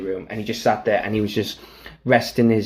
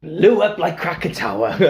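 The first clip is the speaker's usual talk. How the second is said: usual speaking voice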